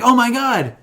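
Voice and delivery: high, loud, overjoyed exclamation; hammed-up awe at an old friend; mock amazement. Retelling the hero seeing his chimp friend arrive, amazed.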